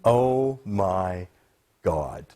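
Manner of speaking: low, drawn-out dismay; heavy, slow disbelief; appalled. Real, not acted. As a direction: let it sink down low, aghast. Appalled reaction to clip.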